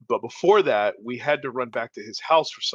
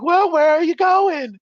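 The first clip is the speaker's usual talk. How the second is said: much higher, slow, prim English mom voice; imitating his mom; 7 dB louder, slower than usual. Narrator imitates friend's English mom leaning into car window. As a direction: lean in like a fussing English mother.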